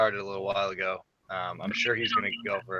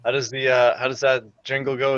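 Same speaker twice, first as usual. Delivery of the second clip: normal talking voice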